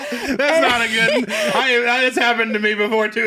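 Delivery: high, laughing through the words; can't stop giggling. Real, not acted. Laughing at story.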